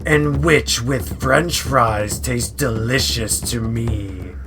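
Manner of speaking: loud, menacing dragon growl; a greedy dragon threatening its meal; scary roaring dragon. A narrator's reading. Dragon roaring at the witch, loud and menacing. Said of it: "a roar that was scary and loud".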